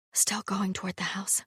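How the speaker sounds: soft unvoiced whisper. A narrator's reading. Hala warning Sarkis about passing constables, whispered. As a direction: keep it to a breath. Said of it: "whispered Hala".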